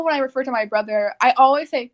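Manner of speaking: ordinary talk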